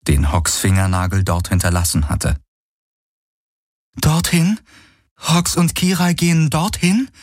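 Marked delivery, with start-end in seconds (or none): 4.0-7.1 incredulous, high-pitched query with rising endings; doubtful question. A narrator's reading. She questions where they are going, doubtful. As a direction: ask it in disbelief, pitch lifted. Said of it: "fragte sie zweifelnd".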